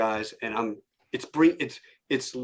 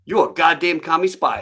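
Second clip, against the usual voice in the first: loud, raised-pitch drill instructor imitation; mock sergeant accusation; 4 semitones higher, 8 dB louder than usual. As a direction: accuse the recruit with comic force. Acted sergeant accuses recruit.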